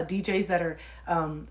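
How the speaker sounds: ordinary talk, nothing marked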